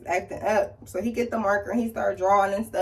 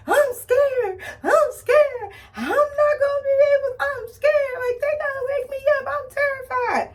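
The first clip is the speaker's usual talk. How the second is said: high, loud, tearful pleading; scared crying; 3 dB louder than usual. Retold or imitated. Telling her family before surgery, high scared crying. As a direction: sob it out like a frightened kid. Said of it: "I just start crying".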